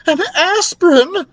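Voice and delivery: very high, loud horrified cry; horrified mother mouse. A narrator's reading. Mother mouse appalled by the dread tablet, horrified cry. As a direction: shriek it in horror.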